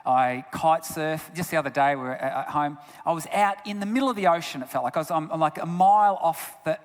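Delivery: ordinary talk, nothing marked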